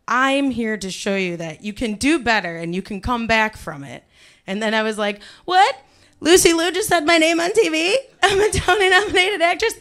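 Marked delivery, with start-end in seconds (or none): 5.5-9.7 high, excited disbelief; amazed disbelief. Retold or imitated. Hearing her Tony nomination on TV, thrilled disbelief. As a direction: squeal it like you can't believe it.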